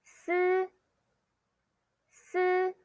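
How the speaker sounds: "si" with hissed s and high level tone; pronunciation model. Shown as a demonstration. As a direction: hold "si" high and even, no ee vowel. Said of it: "S I you say".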